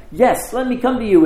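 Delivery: high, loud eager cry; imitating an overeager disciple; exuberant. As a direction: burst out with eager excitement. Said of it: "he's so exuberant".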